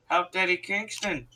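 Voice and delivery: usual speaking voice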